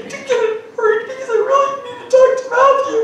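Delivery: high, pleading mock desperation; desperate client. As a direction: beg like an overeager client, laughing.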